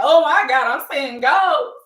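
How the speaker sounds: loud, high mock panic; hammed-up frantic terror; shaken, panicking. Host as Liam seeing Vinny's ghost, acting out loud panic. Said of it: "Liam was really shaken".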